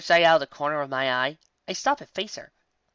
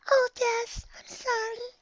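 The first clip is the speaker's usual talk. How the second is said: high, timid, apologetic voice; Fluttershy; slower than usual. A narrator's reading. Shy pony who hit friend with snow, apologetic. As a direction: apologise in a tiny, worried squeak. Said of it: "says Fluttershy".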